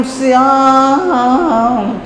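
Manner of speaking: "श्याम" slowly stretched, vowel held long; long drawn-out vowel. Shown as a demonstration. Teacher demonstrating the long vowel mark: "श्याम" slowly stretched. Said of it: "क्या दीर्घ उच्चारण कर रहा है".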